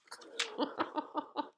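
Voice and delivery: soft unvoiced chuckle; laugh. Real, not acted. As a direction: breath-only laugh at the story's end. Laughs after the story ends.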